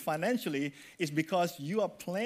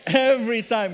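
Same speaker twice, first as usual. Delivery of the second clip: loud group response; 3 semitones higher, 7 dB louder than usual. Shown as a demonstration. Congregation answers the preacher with a loud call. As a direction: shout "Every time" back with the crowd. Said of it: "Everyone say".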